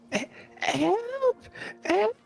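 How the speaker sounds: very high, panicked kobold screaming. Acted. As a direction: shriek for help like a tiny kobold. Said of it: "it is a kobold voice".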